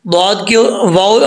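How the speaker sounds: normal talking voice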